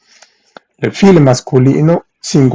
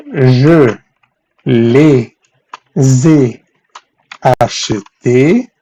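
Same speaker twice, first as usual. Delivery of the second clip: "Je... les ai... acheté", long drawn-out chunks; slow, piece by piece; much slower than usual. Shown as a demonstration. Teacher building the French answer for students, very slowly. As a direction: dictate each piece separately, stretching it.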